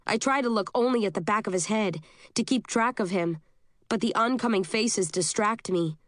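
ordinary delivery

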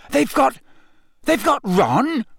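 high, stammered disbelief; falter, then draw out the horrified question; gasping shock. A narrator's reading. Harry realising his friend is taken, gasping. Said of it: "Harry gasped".

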